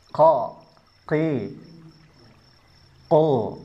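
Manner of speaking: "Ko, ki, ko" with a puff of breath on each k; qaf salah, bernafas. Shown as a demonstration. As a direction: let air escape on "Ko, ki, ko". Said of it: "Ini salah karena ada nafasnya". ("Ko, ki, ko" dengan nafas keluar)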